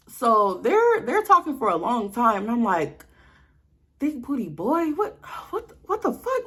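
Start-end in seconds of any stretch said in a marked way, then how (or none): 4.0-6.5 baffled, climbing in disbelief; confused disbelief. Retold or imitated. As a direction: pitch leaps higher on each what. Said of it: "I'm just confused".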